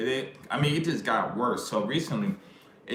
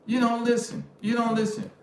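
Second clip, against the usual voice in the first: high-pitched nagging imitation; mock nagging woman; 11 semitones higher than usual. Mimicking women complaining to men, nagging. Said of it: "those are words that women say".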